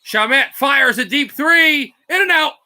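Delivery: tense shot call, climbing; excited call. Real, not acted. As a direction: rise with the shot, then peak. Deep three rims out.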